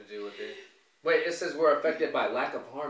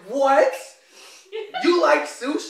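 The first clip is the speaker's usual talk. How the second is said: loud, high shocked "What?"; 13 semitones higher, 13 dB louder, slower than usual. Retold or imitated. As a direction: blurt it out in disbelief. Retelling her shocked reaction.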